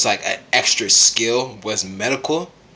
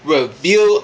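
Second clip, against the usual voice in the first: ordinary talk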